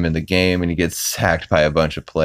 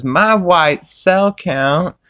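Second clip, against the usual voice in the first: put-on high slow kid voice; 12 semitones higher, 4 dB louder, much slower than usual. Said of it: "the kid goes".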